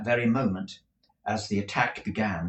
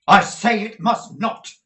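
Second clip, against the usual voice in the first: defiant loud repeat; 11 semitones higher, 11 dB louder than usual. Acted. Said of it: "repeated Nicholas".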